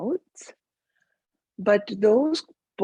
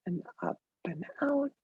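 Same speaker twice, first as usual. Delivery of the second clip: guided meditation; 4 dB quieter than usual. Real, not acted. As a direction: lead them gently into the breath, unhurried.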